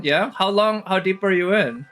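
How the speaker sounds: normal talking voice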